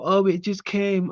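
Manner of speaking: high-pitched mock innocence; mocking imitation. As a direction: parrot her excuse in a high voice. Mocks the woman's excuse.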